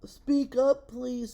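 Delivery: loud, high call to someone hard to hear. A narrator's reading. Elephant asks a faint voice to speak up, calling out. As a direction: raise your voice toward a faint speaker.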